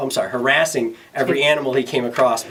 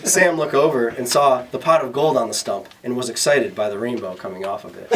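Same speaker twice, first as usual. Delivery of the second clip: plain reading voice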